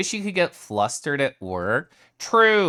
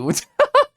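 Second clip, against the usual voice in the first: short squeaky giggle; a quick high-pitched laugh; 8 dB louder than usual. Real, not acted. Giggle at being greeted.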